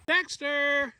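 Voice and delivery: high call across the yard; calling the cat. Real, not acted. Owner calling her cat from afar, high call. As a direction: sing out the cat's name, pitched high.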